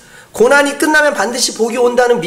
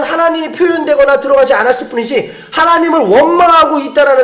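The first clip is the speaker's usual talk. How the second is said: louder, higher preaching at full force; fervent preaching; 7 semitones higher, 3 dB louder than usual. Acted. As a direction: press the congregation hard, voice raised. Preaching climax.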